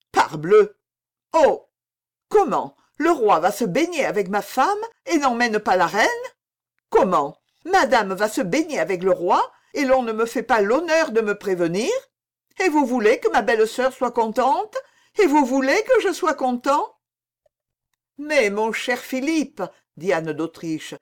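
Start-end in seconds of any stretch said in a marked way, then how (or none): 0.1-17.0 louder, higher, building jealous indignation; indignant outburst. A narrator's reading. Prince's jealous outburst at mother. 18.2-19.6 high, slow motherly reproach; remonstrating. A narrator's reading. Queen mother remonstrates with son.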